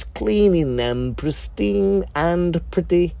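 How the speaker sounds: ordinary delivery, nothing marked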